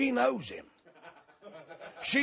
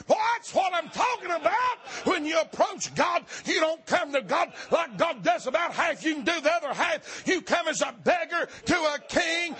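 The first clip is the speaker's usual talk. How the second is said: full-force preaching; 5 semitones higher than usual. Acted. Preacher shouting to the congregation about coming to God. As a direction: preach it out to the whole church.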